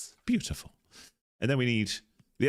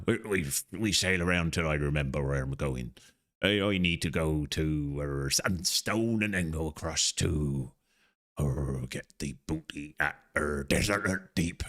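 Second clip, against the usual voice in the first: pirate voice; 3 semitones lower than usual. Pretend. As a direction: play at being a pirate sailing off. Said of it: "I wonder how long I can keep the pirate thing up".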